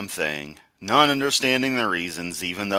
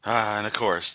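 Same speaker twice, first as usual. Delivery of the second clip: ordinary talk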